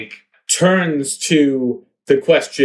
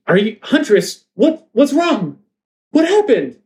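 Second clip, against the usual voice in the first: high, worried questioning; alarmed concern; 10 semitones higher than usual. Acted. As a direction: call out anxiously to a fallen friend. Question worries over fallen huntress.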